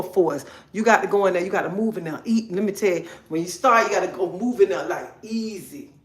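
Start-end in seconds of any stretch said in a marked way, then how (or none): 5.2-5.9 soft, drawn-out easy; gentle easy. Shown as a demonstration. Coaching ladies to start gently, soft slow easy. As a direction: ease into the word, slow and quiet. Shows how to start slowly.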